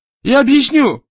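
very high, loud desperate cry; panicked plea. A narrator's reading. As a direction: cry out while being dragged off. Frightened employee dragged away by guards. (отчаянный испуганный крик)

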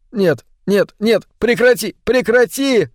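high, frantic begging; panicked wail. A narrator's reading. Pinned man begging the creature to stop, panicked wail. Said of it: "что он завопил вновь".

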